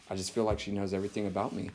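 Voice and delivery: low, quick, quiet aside; mock-unnerved man, voice dropped low; hushed confession, imitated. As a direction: quote it fast and under the breath. Acting out unnerved men at church.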